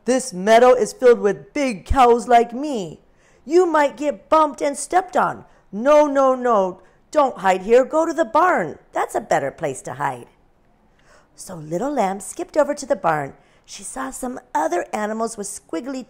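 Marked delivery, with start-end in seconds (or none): 0.0-10.3 big friendly cow warning. A narrator's reading. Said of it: "said the cow".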